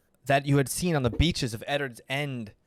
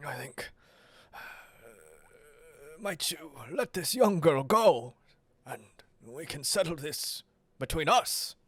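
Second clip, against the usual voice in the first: faltering, half-whispered voice rising in pitch; Eddard; 4 dB quieter than usual. Acted. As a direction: plead haltingly, breaking in and out of whisper. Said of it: "Eddard back there".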